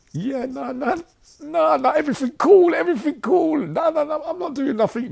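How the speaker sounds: very high, loud flustered denial; panicky denial. Retold or imitated. Escobar denying it to the guards, high panicky denial. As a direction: squeak out your innocence in front of the guards. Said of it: "Escobar went like this, look".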